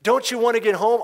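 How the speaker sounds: retold soldier yell, pushed harder. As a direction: hammer the question home a second time. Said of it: "he yells at the boy".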